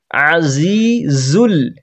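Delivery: আজিজুল with a slow stretched ain; long drawn azizul. Shown as a demonstration. Teacher demonstrating the ain in আজিজুল. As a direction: draw out আজিজুল, pressing the ain from mid-throat. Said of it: "গলার মাঝখান থেকে হালকা চেপে বলতে হবে".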